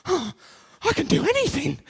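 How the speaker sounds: gasp, then crow it as if invincible; completely elated. Acted. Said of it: "being completely elated".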